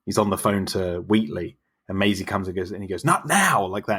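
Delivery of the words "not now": sharp, higher and louder snap; yelled. Retold or imitated. Host imitates Mills yelling at Maisie, higher and louder. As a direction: brush the child off with a yell. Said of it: "he, like, yells at her".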